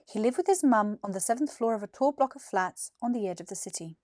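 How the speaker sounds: usual speaking voice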